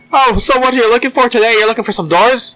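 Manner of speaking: high hammed-up Irish clerk; stage Irish shop talk; mock Irish accent. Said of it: "accent day".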